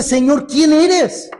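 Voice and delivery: Saul's awed question. Retold or imitated. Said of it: "él le dice".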